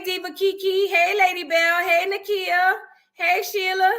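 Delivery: normal talking voice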